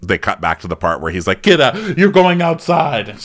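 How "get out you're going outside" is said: high, drawn-out retold command; mock order. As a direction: imitate him ordering her out. Chris ordering her out, acted.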